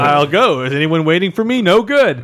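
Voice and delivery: loud, high, overacted hero; hammy stage actor. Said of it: "really reaching for the stars with his overacting".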